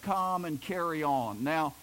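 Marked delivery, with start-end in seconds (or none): none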